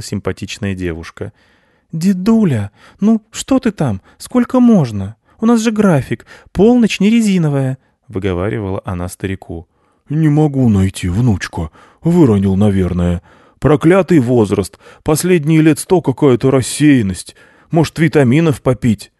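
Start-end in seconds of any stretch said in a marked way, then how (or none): 1.9-7.8 put-on much higher, louder young girl nagging; impatient Snegurochka. Snegurochka scolds grandfather. 10.1-19.1 put-on louder, weary old man's lament; a fussy forgetful old grandpa; old Grandfather Frost. Grandfather Frost complains to granddaughter about his age, louder old voice.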